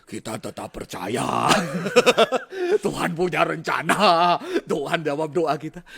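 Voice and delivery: mock pulpit proclamation, drawn out and loud; slow, swelling sermon delivery, half joking; preacher impression. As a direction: imitate a preacher declaring faith, hammed up. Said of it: "kadang-kadang pendetanya kayak nggak takut".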